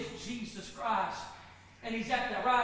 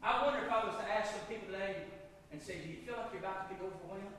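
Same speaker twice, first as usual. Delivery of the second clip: low, soft pastoral voice; hushed, lowered invitation; quiet altar appeal; 3 semitones lower, 8 dB quieter than usual. Real, not acted. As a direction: bring it right down and invite them gently.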